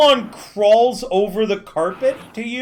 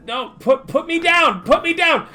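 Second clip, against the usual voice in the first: high, protesting squeal; 9 semitones higher than usual. Acted. NPC lifted by the scruff, squealing put me down. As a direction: wriggle free in protest.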